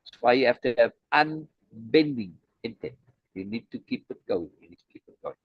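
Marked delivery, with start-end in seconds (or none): 3.3-4.5 low, quiet and intent, almost unvoiced; hushed emphasis. Real, not acted. Teacher hushing to stress keeping the pressure on, quietly. Repeating key advice to students. 4.6-5.3 a near-silent whisper, much softer; whispered repeat. Real, not acted. Teacher whispering the lesson back to students, whispered.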